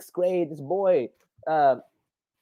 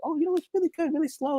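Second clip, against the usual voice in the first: imitated shop worker; 11 semitones higher, faster than usual. Donut shop worker answering how the day is, high imitated voice. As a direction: lift it high for a polite reply.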